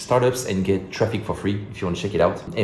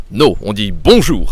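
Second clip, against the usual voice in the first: put-on "on dit bonjour" in a crisp native French accent, louder; French correction; 10 dB louder than usual. French people correcting a foreigner's greeting, mock-prim French. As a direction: correct them like a fussy Parisian. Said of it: "people will say".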